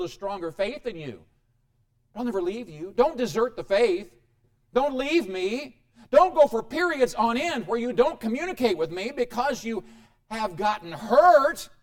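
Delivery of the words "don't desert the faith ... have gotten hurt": loud, high pleading; preacher pleading with the congregation. Real, not acted. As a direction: beg them not to leave.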